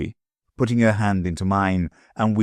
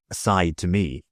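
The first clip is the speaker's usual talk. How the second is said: plain reading voice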